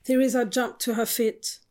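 ordinary delivery

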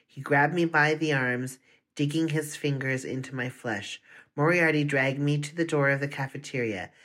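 plain reading voice

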